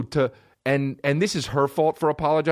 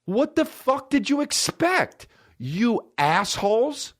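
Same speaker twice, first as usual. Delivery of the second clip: exasperated rant; 11 semitones higher, slower than usual. Real, not acted. Ranting at critics.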